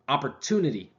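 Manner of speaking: "opportunity" with the stress on "tu", falling; correct stress. Shown as a demonstration. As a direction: say "opportunity" as op-por-TU-ni-ty. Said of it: "put the emphasis on another part".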